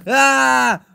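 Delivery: high, loud startled scream; sudden scream. Acted. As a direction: shriek at the sudden fright. Sees the monster again.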